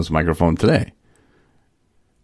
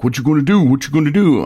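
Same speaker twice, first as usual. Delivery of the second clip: much higher, mocking the guy's pushy taunt; taunting challenge; 9 semitones higher, 3 dB louder, faster than usual. Rehab guy getting in his face, hammed-up high taunting. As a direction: taunt it like a guy itching for a fight.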